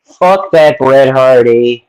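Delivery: slow, loud Canadian "eh"; mock Canadian accent. Friends joking about wrestlers, slow loud mock Canadian accent. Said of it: "They're like".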